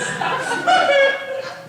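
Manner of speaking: squealing high laugh, real; cracking up loud and high. Real, not acted. Comedian to the audience, squealing high laugh. Laughs at her own prank.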